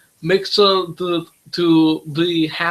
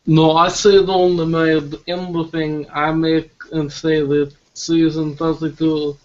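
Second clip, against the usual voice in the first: ordinary talk, nothing marked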